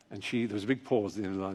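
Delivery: normal talking voice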